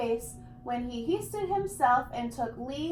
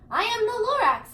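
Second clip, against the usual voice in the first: higher, put-on voice of a grumpy little forest creature; Lorax voice; 8 semitones higher, 5 dB louder than usual. The Lorax confronts the Onceler, pitched high and indignant. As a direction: announce yourself with prickly pride.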